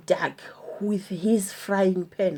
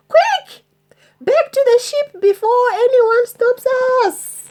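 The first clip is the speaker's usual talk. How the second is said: high loud urgent hurry; 6 dB louder than usual. Acted. Pirate urging crew back to ship, high urgent call. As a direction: rush them back to the ship.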